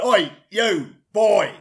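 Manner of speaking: loud, barking rude customer; bossy diner summoning staff; demanding diner. Acted. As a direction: order the waiter about, punchy word by word. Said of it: "and he went".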